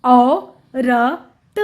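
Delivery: औ, र, त very slow and separate; sounding out. Shown as a demonstration. Teacher showing how it is written, sound by sound. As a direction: split it with pauses: औ... र... त. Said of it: "इस तरह से औरत लिखते हैं".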